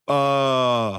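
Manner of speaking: long, low, blank drawn-out sound; dumbfounded. Retold or imitated. Podcast guest mocking how people freeze before a star, dumbfounded. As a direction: play someone thrown off and stuck for words. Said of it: "like, mentally challenged when he speaks to you".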